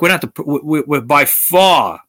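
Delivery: ordinary talk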